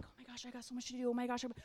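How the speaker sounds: fast, soft frazzled muttering; hurried, under-the-breath fretting; mock flustered. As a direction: imitate someone rushing through a swamped to-do list. Imitates a distracted, overloaded reader.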